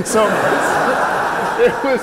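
ordinary talk

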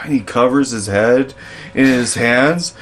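ordinary talk

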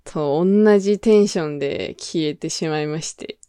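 ordinary talk

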